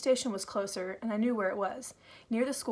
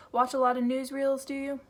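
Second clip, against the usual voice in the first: smirking mockery; 5 semitones higher, 6 dB louder than usual. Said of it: "his smile widening into a smirk".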